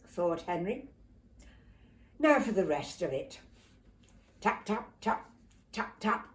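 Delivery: ordinary delivery, nothing marked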